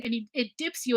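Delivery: ordinary delivery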